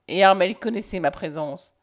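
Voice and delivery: ordinary delivery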